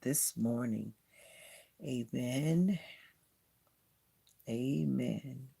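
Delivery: low, hushed, sincere prayer; low-voiced personal prayer to God. Real, not acted. Leads a closing prayer.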